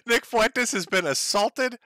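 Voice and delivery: amused incredulous repeat; laughing. Real, not acted. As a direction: laugh in disbelief while repeating it.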